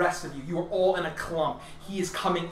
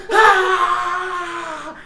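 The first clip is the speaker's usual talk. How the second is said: high, loud scream with a laugh in it; villain power-up scream; 13 semitones higher, 10 dB louder than usual. Acted. GM voicing the alien screaming as he grows taller. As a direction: let out a hammed-up powering-up roar. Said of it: "you hear the voice say".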